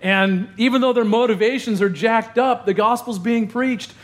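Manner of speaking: high, lifted voice of rejoicing; a lightly voiced joyful Paul. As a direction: sound glad despite prison. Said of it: "He's rejoicing".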